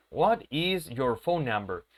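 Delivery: slow, "What is" left uncontracted; word-by-word demo, "is" said in full; words pronounced separately. Shown as a demonstration. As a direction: keep "What" and "is" as two distinct words. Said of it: "las pronunciemos por separado".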